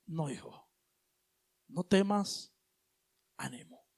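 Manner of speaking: put-on calm a frightened child; God's tender reply. God answering the frightened believer. (Dios le responde al hijo con voz suave y tierna)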